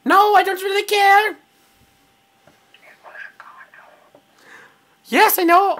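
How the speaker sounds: high-pitched, stiff alien voice; Conehead. Acted. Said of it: "I'm gonna do my Conehead impression".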